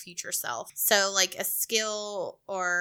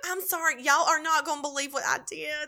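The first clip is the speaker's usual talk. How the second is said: higher-pitched, sheepish Southern confession; Southern country dumb act; 6 semitones higher than usual. Pretend. Southerner playing dumb to callers, apologetic country voice. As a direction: put on an apologetic country twang. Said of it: "and talk real slow and real country".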